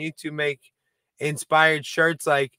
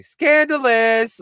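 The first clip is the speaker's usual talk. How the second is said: "Scandalous!" high, loud and very slow; Ashley-style "Scandalous!"; 8 semitones higher, 5 dB louder, much slower than usual. Shown as a demonstration. Host demos the catchphrase as the Ashleys say it, high and loud. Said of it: "say it like them afterwards".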